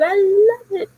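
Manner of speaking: high, squealy I love it; gushing. Retold or imitated. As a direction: squeal with joy. Boss asks about job.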